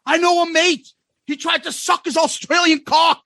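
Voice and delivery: high, insistent Aussie impression; mock Australian barroom holler; loud Australian. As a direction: insist on it like a loudmouth drunk Aussie.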